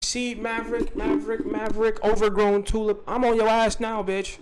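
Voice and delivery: high, insistent taunt on a level pitch; taunting call-out. Real, not acted. Calling out an absent target.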